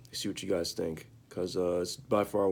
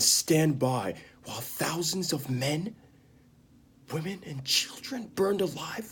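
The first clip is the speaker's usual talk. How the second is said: higher-pitched challenge building from restrained to a loud, anguished shout; Jaime Lannister challenging; 6 semitones higher, 4 dB louder than usual. Acted. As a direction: press the question harder until it bursts into a shout. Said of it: "I'm gonna perform it right now".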